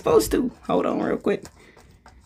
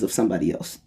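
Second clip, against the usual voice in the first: starts plain then drops to a whisper; fading to a whisper; 9 semitones lower, 7 dB quieter, faster than usual. Shown as a demonstration. Coaching viewers on energy, trailing into a whisper. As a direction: let it sink into a hush. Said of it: "I'm gonna talk to you on a cer-, on the fifth level".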